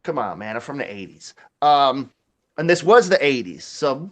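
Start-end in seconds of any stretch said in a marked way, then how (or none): none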